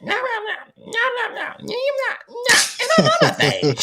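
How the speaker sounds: very high-pitched mock nagging; hammed-up high squeal of a scolding mom; barking mom. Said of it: "I heard her in there barking at".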